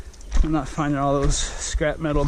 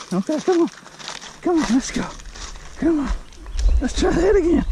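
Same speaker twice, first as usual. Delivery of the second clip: doggy voice; 8 semitones higher than usual. Real, not acted. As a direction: coo up high like talking to a puppy. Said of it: "in doggy voice".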